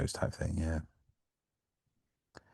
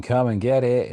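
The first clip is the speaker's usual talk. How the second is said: a light Cockney call, higher and louder; 6 semitones higher, 6 dB louder than usual. Retold or imitated. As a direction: call it out in a mild Cockney accent. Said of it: "very Cockney voice".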